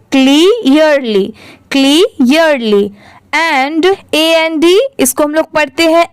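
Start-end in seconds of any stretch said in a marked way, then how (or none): 0.1-2.9 "क्लियरली" slowly split as klee-yer-lee; syllable-by-syllable pronunciation. Shown as a demonstration. Teacher showing learners how to read it, slow split "क्लियरली". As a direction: draw out the parts of "क्लियरली". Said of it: "इसको हम लोग क्या पढ़ेंगे?".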